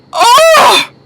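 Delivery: high, drawn-out "oh" of shock; shocked reaction. Retold or imitated. Host recalls reacting to the selfie question, shocked. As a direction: re-enact a stunned, high-pitched gasp of "oh".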